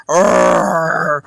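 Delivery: low growling cartoon roar; knight roar. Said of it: "he was like".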